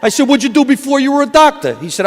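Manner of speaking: normal talking voice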